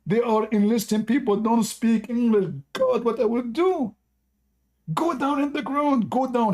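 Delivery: hammed-up drill sergeant screaming. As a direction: rant at the recruit in mock despair. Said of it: "And he starts screaming".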